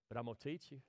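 soft and hushed, God reassuring a scared new father; God's gentle voice. Acted. God gently promising the preacher, soft and reassuring. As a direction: drop to a near murmur and promise patiently.

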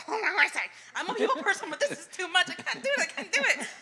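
high, laughing overwhelmed squeal; mock panic. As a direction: act out comic overwhelm, high-pitched.

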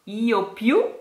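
ordinary talk

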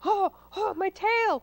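high-pitched yelp of pain; pained cry. A narrator's reading. Fox's tail is shut in the door, crying out. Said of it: "cried the mean".

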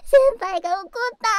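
loud, high giddy squeal; delighted squeal. Acted. Squealing that senpai got angry.

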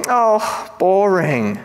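hammed-up bored disappointment; mock letdown. Reacting to Paul's answer, mock disappointed. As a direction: let the pitch sink in disappointment.

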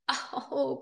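drawn-out acted groan; pained groan of a bird. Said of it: "groaned the hawk".

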